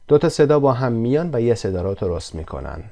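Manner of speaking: ordinary talk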